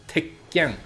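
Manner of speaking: "Tekken" with a held stop on the double k; doubled consonant. Shown as a demonstration. Teacher demonstrating the small tsu, doubled k in "Tekken". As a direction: cut "Tek" short, pause, then land "ken". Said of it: "emphasis on the consonant sound".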